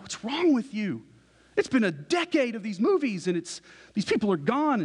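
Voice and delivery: mock outrage. Brother scolding an uninvested sibling, mock outraged. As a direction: imitate a devoted fan appalled at indifference.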